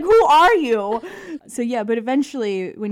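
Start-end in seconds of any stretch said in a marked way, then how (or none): 0.0-1.0 loud, high, incredulous; who are you, indignant; mock outrage. Asking the boyfriend in loud mock outrage.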